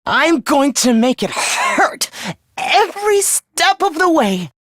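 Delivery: slow threat rising high; menacing threat. Acted. Villain promising Takumi a painful death, vicious menace. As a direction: draw the threat out, climbing in fury.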